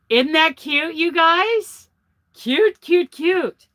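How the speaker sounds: high, loud cooing over something cute; delighted, high-pitched fussing; gushing delight. Real, not acted.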